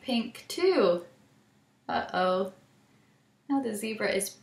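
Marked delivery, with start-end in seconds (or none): none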